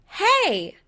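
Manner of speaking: bright high call over a crowd; loud call. A narrator's reading. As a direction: hail the whole room at once. Calling out to the crowd.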